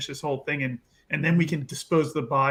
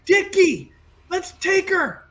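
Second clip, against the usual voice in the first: entranced character voice; 12 semitones higher, 4 dB louder than usual. Acted. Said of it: "he's just gonna be like".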